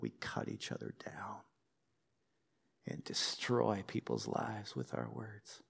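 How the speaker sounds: low breathy whisper; hushed whisper. Acted. Pastor warning the church, grave whisper. Warning about hurtful words.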